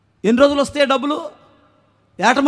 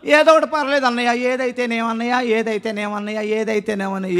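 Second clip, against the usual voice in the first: imitating someone with no preference, trailing softer; lightly mimicked indifference, quieter with each repeat; vague petitioner; 6 dB quieter than usual. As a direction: repeat it like a mock mumbling petitioner, sinking away. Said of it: "ఒకళ్ళు అంటారు".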